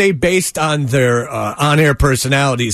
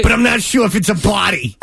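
higher-pitched hammed-up Boston caller; mock Boston accent; 5 semitones higher than usual. Mocking the reenacted emergency caller, hammed-up Boston accent. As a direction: parody a bad Boston accent. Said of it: "to do a Boston accent".

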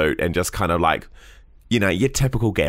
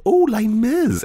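excited; 3 dB louder, slower than usual. Retold or imitated. Excited at finding DVD.

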